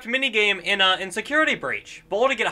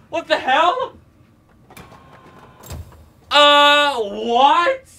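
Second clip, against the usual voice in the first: shocked; 7 semitones higher, 6 dB louder, much slower than usual. Real, not acted. Shocked after jumpscare.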